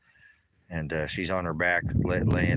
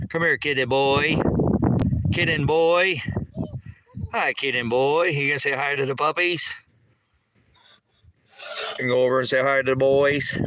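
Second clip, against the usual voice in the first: high, louder sing-song pet voice; 7 semitones higher, 4 dB louder than usual. Real, not acted. Owner calling the kitten to greet the puppies, high baby talk. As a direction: coo at the kitten.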